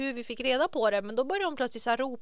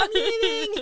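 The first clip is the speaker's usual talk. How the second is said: dramatic exit; 9 semitones higher, slower than usual. Retold or imitated.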